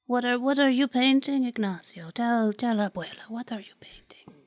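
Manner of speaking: put-on whispery old abuela; old grandmother voice. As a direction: invite like a frail grandma.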